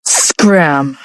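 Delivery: low, menacing growl; growled scram. A narrator's reading. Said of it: "Dalen growled in a terrifying manner".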